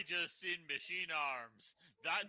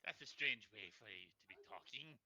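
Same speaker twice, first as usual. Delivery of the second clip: lower, quiet put-on voice; lower character voice; 6 semitones lower, 7 dB quieter than usual. Debussy telling the GM's character he talks strangely, low voice. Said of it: "I've s-, lost the voice".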